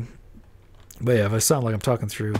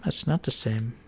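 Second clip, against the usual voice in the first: ordinary delivery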